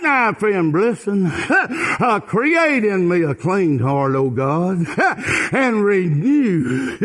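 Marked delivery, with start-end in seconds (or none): none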